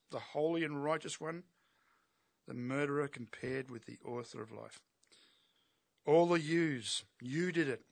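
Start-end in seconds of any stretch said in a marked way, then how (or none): 6.0-7.8 louder, slow, stress on "yous" and "you"; hammering the yous. Acted. Peter to the crowd, hammering every you. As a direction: drive home "All the yous, you did it". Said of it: "He really gives it to him".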